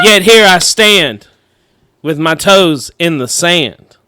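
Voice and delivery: loud, slow, grand beat poet recital; terrible beat poet. Pretend. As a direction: declaim it like a bad beat poet. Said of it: "terrible beat poet".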